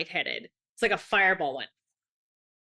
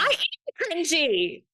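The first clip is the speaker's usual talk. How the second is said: proud declaration; 7 semitones higher, much slower than usual. Retold or imitated. Proudly declares herself cringy.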